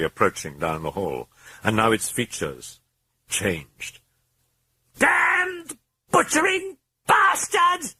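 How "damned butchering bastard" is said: very high, slow, screaming British voice; furious British screech; British shriek. A narrator's reading. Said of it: "shrieked in the hoarse British accented voice".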